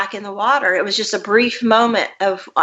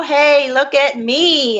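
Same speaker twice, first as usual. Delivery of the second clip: calling out; 5 semitones higher, much slower than usual. Retold or imitated. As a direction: call out to be noticed. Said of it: "and I yell".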